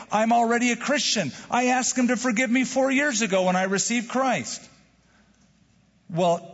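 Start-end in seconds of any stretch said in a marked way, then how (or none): 0.1-4.6 higher-pitched mock objection; indignant protest. Believer objecting to daily confession, mock indignant protest. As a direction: protest it as a self-satisfied objector.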